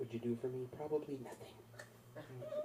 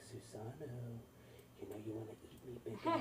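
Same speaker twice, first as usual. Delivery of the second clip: soft, coaxing voice of tempting food; seductive food; 7 dB quieter than usual. Retold or imitated. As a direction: tempt the driver softly, lifting at the end. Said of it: "the food's looking at me like".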